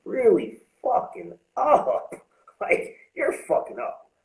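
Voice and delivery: high, slow self-scolding; talking sense into his own drunk self; scolding himself. Retold or imitated. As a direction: tell yourself off, drawn out. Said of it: "I was just like".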